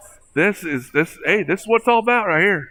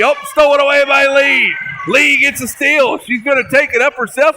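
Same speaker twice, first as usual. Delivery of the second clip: high, loud, rapid-fire game call; excited play-by-play; 7 semitones higher, 4 dB louder than usual. Real, not acted. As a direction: call the action as it unfolds, higher and louder. Exciting final seconds play-by-play.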